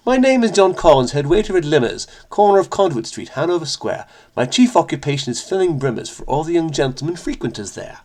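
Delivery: hammed-up British accent, lilting and soft at line ends; bad British accent. Vlogger reciting John Collins the head waiter's rhyme, bad British accent. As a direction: put on a badly faked British accent. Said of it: "bad British accent".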